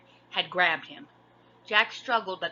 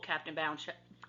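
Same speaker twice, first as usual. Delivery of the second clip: usual speaking voice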